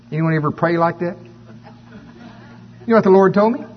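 ordinary delivery

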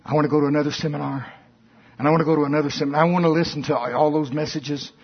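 fast, eager mock chatter; imitating a seminar-chaser, hurried; mock eager rush. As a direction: rattle it off like a seminar junkie. Mocking seminar-chasing Christians.

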